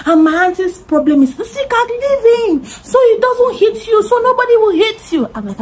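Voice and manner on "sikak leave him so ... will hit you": high, tearful, half-laughing plea; panicked auntie. Retold or imitated. Auntie begs her niece to let go of Tim, tearfully. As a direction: wail it like a frightened aunt.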